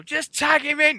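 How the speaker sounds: high-pitched mock yell; imitated crowd heckle. Imitating the crowd going mental, high heckle. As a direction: call out like an impatient fan. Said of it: "that crowd was going mental".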